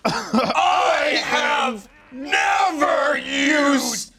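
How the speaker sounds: defensive shout. Acted. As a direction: shout your innocence at the press. Player shouting at reporter.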